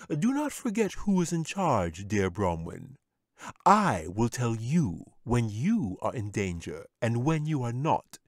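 lightly voiced smooth wizard; a hint of a wizard calming his pet. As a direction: soothe the bear like a sly wizard. Wizard reasserts control.

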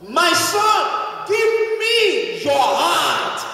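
preacher voicing God's plea slowly; slow solemn quote. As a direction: stretch God's call out. Voicing God's call, slowed.

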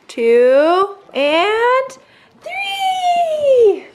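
high, rising playful count with laugh; excited counting. Real, not acted.

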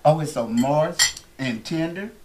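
ordinary talk

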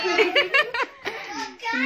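high burst of laughter. Real, not acted. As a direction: laugh freely at the slip. Laughs at forgotten recording. (ऊँची खुली हँसी)

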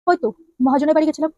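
usual speaking voice

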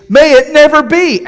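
loud, high cry of "May it never be"; preacher crying out Paul's refusal; emphatic denial. Retold or imitated. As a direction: fling it out as a ringing no. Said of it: "It means".

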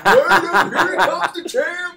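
slow, high, loud ring announcer call; hype man. Pretend. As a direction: hype the champ's entrance with a laugh. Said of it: "I'll be your hype man".

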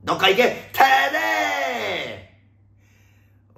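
high, long-drawn yell; ranting shout. Pretend. Host telling someone to get lost, ranting shout. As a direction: bellow them away, stretching the last vowel.

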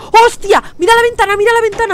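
high, loud frightened shout; startled shout. Real, not acted. Gamer spots something at the window, frightened shout. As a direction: cry out in real fright.

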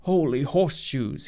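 ordinary delivery

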